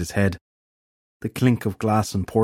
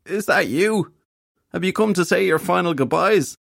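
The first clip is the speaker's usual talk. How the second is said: high, pitiful hungover croak; weak croak; 9 semitones higher than usual. A narrator's reading. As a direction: plead feebly, as if on your deathbed. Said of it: "his voice coming out in a weak croak".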